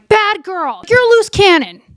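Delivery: high-pitched retold reproach; hammed-up indignant mimicry; mock scolding. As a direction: act out him telling her off. Acted scolding of the woman.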